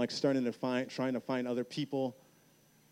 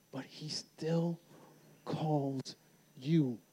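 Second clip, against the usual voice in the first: slow preaching emphasis; much slower than usual. Acted. Sermon climax, slow appeal.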